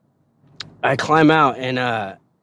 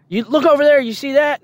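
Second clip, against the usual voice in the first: drill sergeant voice; 10 semitones higher than usual. Retold or imitated. Drill sergeant quoted, high.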